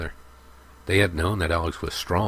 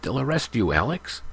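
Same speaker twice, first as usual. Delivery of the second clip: higher, urgent; urgent warning; 6 semitones higher than usual. A narrator's reading. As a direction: alarm him before it's too late. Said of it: "warned Kyle".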